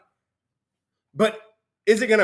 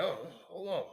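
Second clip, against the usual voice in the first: hushed inner doubt; 2 semitones lower, 16 dB quieter than usual. Retold or imitated. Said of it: "You're like".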